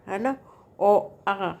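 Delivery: usual speaking voice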